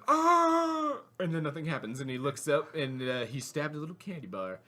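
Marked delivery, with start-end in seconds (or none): 0.1-1.0 high, loud mock scream; long hammed-up "aah" of fright; imitated scream. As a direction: scream it out in comic terror. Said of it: "and he's screaming".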